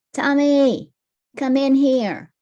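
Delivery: loud, high call across the house; mom calling out. Acted. Mom yelling for Tommy to come in, loud call. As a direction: shout for him to come in.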